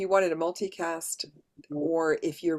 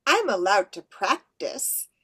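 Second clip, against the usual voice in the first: lightly voiced fairy, slow; a fairy answering, a bit slow; 4 semitones higher, 5 dB louder than usual. Shown as a demonstration. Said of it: "So remember, it's fairies".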